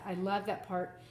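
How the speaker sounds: ordinary delivery, nothing marked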